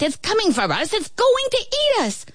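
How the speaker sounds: rising high panic; panicked. A narrator's reading. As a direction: panic as the spider comes. Ant panics at spider.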